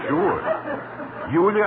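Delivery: mock Irish voice. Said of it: "I changed my voice with Irish dialect".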